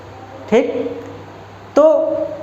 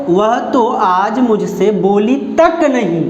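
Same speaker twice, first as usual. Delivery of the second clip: slow, with तक leaned on and falling; stress on तक; slower than usual. Shown as a demonstration. Teacher showing where the stress lands, on तक. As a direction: slowly hit तक hard. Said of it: "यहाँ बल दे रहे हो उस पर".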